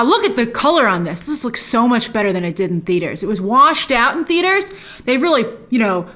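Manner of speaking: ordinary delivery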